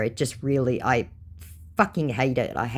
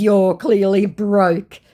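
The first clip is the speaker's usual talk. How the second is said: mocking scammer voice; 6 semitones higher, 7 dB louder, much slower than usual. Reading the scammer's reply.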